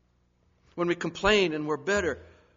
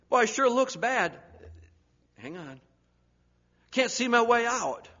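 alarmed outcry; 7 semitones higher, 6 dB louder than usual. Retold or imitated. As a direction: sound troubled. Voicing worried believer.

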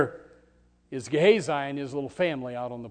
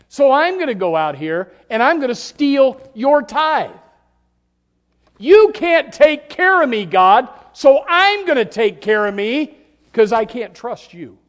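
higher, louder, slow accusing cry at God; defiant shout; 11 semitones higher, 15 dB louder than usual. Acted. Preacher voicing a sinner shouting at God, loud and high. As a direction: hurl it at heaven, building.

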